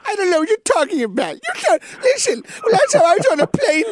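high, loud showbiz diva voice; [name] impression. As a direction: camp it up as Liza. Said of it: "can you do some [name]?".

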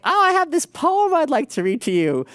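mock prim man. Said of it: "He's like".

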